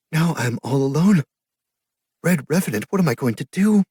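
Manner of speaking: very high, loud, desperate plea; despairing cry. A narrator's reading. Orphaned sister pleading with Red Revenant, high despair. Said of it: "her eyes filled with despair".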